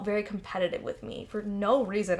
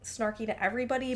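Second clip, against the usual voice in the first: normal talking voice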